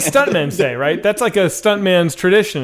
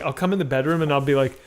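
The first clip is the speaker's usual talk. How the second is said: normal talking voice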